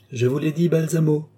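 understated defiant cry; defiant exclamation. A narrator's reading. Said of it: "s'écria Lorenza".

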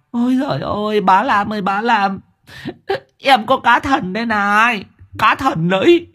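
high, eager excited telling; mild high-pitched excitement. Acted. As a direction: show off the magic fish breathlessly. Excited man shows magic fish.

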